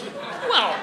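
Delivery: very high drawn-up "well"; mock hedging. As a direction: imitate squeaky, evasive hedging. Bob voiced dodging a lie question.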